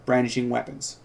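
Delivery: ordinary talk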